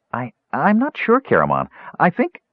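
high, halting kender voice; a small, unsure kender stammering; high and uncertain, trailing off. Acted.

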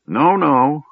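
high, falling sighed denial; sighing. A narrator's reading. Mage reassuring Tas about the orb, sighing. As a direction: dismiss it with a sigh. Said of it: "Fizban sighed".

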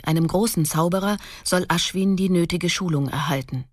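ordinary talk

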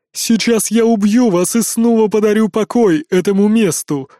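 loud, thundering giant woman; thundering giantess voice. Acted. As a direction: thunder the threat like a titan. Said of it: "прогромыхала женщина".

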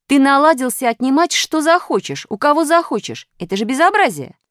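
high, loud telling-off; indignant scolding. A narrator's reading. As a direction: pile up the accusations in outrage. Pyatyorka scolds Minus.

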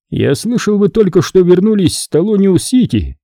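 forced friendliness, pitched high; colonel's voice. A narrator's reading. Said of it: "сказал он".

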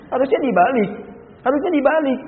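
loud, high repeated insistence; preacher insisting it must be reversed; insistent. Real, not acted. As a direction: hammer home the reversal.

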